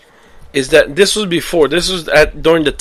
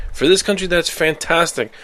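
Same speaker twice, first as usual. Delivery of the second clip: normal talking voice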